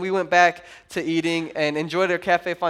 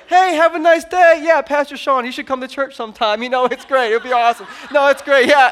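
bright, louder, overly friendly chatter; innocent cheerful host; 4 semitones higher, 5 dB louder than usual. Retold or imitated. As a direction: act innocent while hiding spoons. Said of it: "And I was like".

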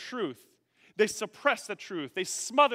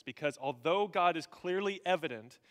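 ordinary talk, nothing marked

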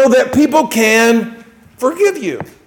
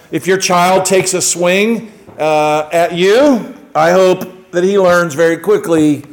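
ordinary talk, nothing marked

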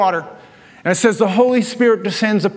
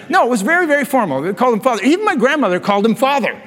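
ordinary delivery

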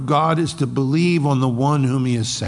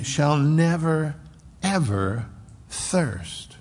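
slow "never", pause, "ever", pause, "thirst"; emphatic double negative; 3 semitones higher, much slower than usual. Shown as a demonstration. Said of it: "it's a double negative".

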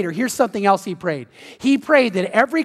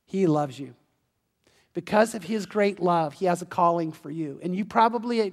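gentle altar call; 3 semitones lower, 6 dB quieter than usual. Acted. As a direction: lower the voice and plead gently with the unbeliever.

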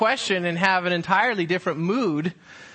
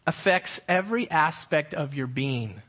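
usual speaking voice

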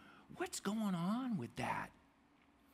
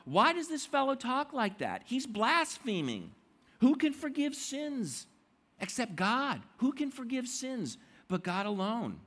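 higher and louder, outraged; indignant religious leaders; 7 semitones higher, 4 dB louder than usual. Retold or imitated. Leaders of the law outraged at Jesus forgiving sins. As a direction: protest like scandalized teachers of the law. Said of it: "they're thinking to themselves".

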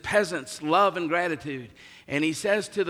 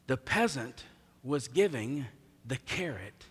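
softer, lower, king's calm correction dropping away; quiet rebuke; 4 semitones lower, 6 dB quieter than usual. Acted. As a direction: speak it close and quiet, like a king setting him straight. King quietly rebukes the nobleman.

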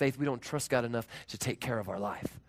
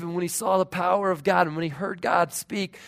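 ordinary talk, nothing marked

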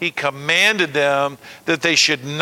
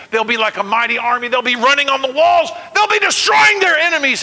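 rising triumphant; 6 semitones higher than usual. Acted. Preacher to church about the mighty army, building to a shout. Preacher voicing God's promise of victory.

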